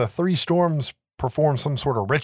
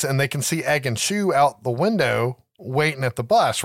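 ordinary delivery

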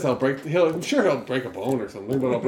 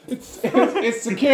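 high-pitched, words broken by laughter; laughing; 7 semitones higher, slower than usual. Real, not acted. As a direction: barely get it out while cracking up. Player laughing at failed door throw.

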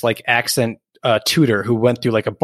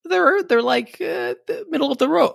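much higher, quick sing-song Minnesota voice; Minnesota accent; 12 semitones higher, faster than usual. Pretend. Hosts rating credits, high Minnesota accent. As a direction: chirp it like a Fargo local.

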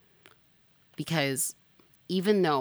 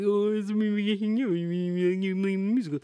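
mocking the boy; 5 semitones higher than usual. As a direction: play the awkward boy who shows up at her door. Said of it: "show up at their house later and be like".

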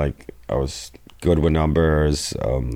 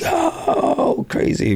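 mock amazed; much slower than usual. Friends reacting to the big line.

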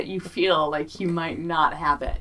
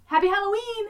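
much higher cartoon squeal; squeaky sign-off. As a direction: chirp it like a cartoon character.

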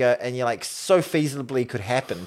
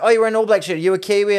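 high, loud imitation of eager questioning; eager approach; 10 semitones higher, 8 dB louder, faster than usual. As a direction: call out as if spotting someone. Acting eager reporters approaching supposed Kiwis.

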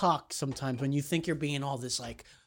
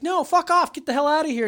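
high, raised-voice imitation of ordering out; mock angry refusal; 8 semitones higher, 4 dB louder than usual. Shop worker ordering the customer out, mock angry. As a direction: throw someone out, imitating anger.

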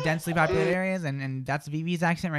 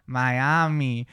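imitated Miami accent; 4 semitones lower than usual. Host imitates Brandon's Miami accent. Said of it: "He's like".

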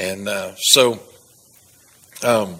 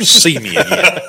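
laughing; 8 semitones higher, 9 dB louder than usual. Retold or imitated. Promising the officer, breaks into laughter.

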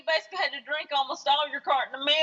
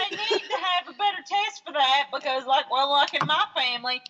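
ordinary talk

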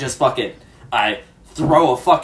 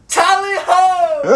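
high, slow stagey shout; mock battle cry; 5 dB louder, much slower than usual. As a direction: cry it like an old-time soldier.

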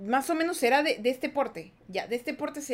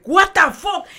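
loud, high retold "what the fuck"; jolted awake in shock; startled disbelief; 6 semitones higher, 11 dB louder, slower than usual. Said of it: "de golpe". (sobresalto incrédulo, fuerte y agudo)

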